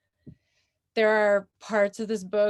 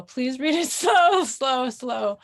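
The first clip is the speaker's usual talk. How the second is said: higher, louder plea with a laugh in it; mock pleading; 4 semitones higher, 7 dB louder, slower than usual. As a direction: beg them playfully, leaning hard on slow. Acted plea to fast-reading friends.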